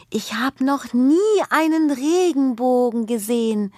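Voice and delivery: hoch und staunend; begeistert rufen. Acted. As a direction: voller Staunen ausrufen. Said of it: "ruft Juna begeistert". (Kind ruft begeistert beim Anblick des Regenbogens)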